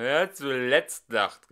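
slow loud threat; threatening call. Acted. Singer threatens his rivals, slow and loud. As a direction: draw out a menacing warning. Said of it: "rief er drohend".

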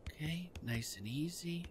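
hushed sneaking whisper; whispered soothing while sneaking. Real, not acted. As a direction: breathe it out softly so she won't notice. Sneaking up, calming the lady.

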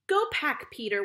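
ordinary delivery, nothing marked

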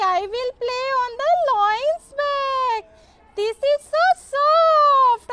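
high squeaky mouse voice, drawn-out words; playful mouse, high and sing-song; gleeful little mouse. Acted. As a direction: squeal with delight as a tiny mouse. Mouse playing on lion's back.

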